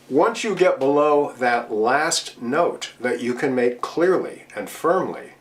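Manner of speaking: ordinary delivery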